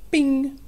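high, loud bing; ping it out high; bing effect. A narrator's reading. Narrator adding a sound effect for kids, high bing. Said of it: "sound effects in here".

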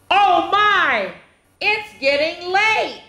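high, fretful little voice; worried pumpkin. Acted. First pumpkin on the gate, fretting in a high voice. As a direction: fret that it's getting late. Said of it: "The first one said".

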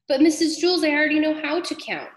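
put-on high, quick childish protest; a boy insisting he can count; protesting kid. Joe protests to teacher.

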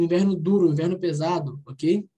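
ordinary delivery

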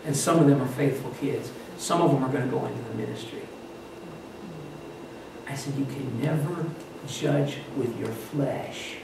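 low, soft, measured counsel; quiet earnest confiding. Retold or imitated. Pastor taking the camp speaker aside after service, low quiet earnest talk.

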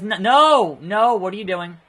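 loud, high cry of protest; alarmed protest. Real, not acted. Player protests the opponent's move, alarmed. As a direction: cut yourself off with a shouted objection.

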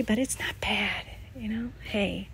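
half-embarrassed whispered reassurance; whispered aside. Real, not acted. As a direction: whisper it almost to yourself. Whispered aside about dirty glove.

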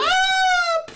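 put-on squeaky girl-character cry; high squeal.

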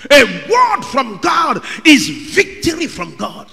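loud, high, drawn-out preacher's shout; fiery sermon climax; preaching at full force. Real, not acted. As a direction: thunder it over the congregation.

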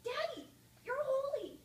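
put-on high, loud child's cry of wonder; a small girl announcing to church; little girl voice. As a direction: squeal it like a proud five-year-old. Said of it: "I was holding your hand and I said".

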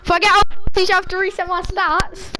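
loud, high, incredulous question; can't believe what he's hearing; shocked disbelief. Real, not acted. Disbelief at having to reset.

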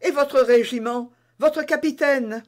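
startled cry. Acted. Constance to D'Artagnan, worried about his regiment, alarmed. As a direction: press him, alarmed he forgets duty. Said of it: "s'écria madame Bonacieux".

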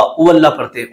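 usual speaking voice

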